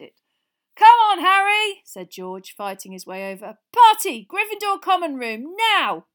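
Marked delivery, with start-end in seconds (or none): 0.7-1.8 loud, high and slow call. A narrator's reading. George calling Harry to the party, loud. 3.7-6.1 loud, high rallying call; rally everyone to the party; excited shout. A narrator's reading. George summoning the team, excited shout.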